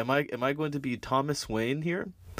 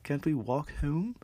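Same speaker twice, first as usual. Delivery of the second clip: put-on juvenile kid voice; 5 semitones higher than usual. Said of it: "as juvenile as".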